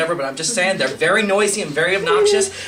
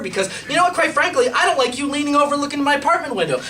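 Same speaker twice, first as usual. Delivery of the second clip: retold telling-off; 8 semitones higher than usual. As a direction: work yourself up as you tell the neighbor off. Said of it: "And I'm like".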